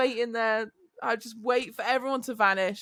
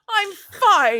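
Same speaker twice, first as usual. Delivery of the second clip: put-on child's protest; 8 semitones higher, 9 dB louder than usual. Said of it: "he's like".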